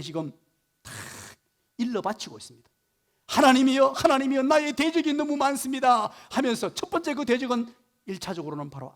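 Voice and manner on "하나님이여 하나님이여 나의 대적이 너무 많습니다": loud pleading prayer. Acted. Voicing David's plea to God.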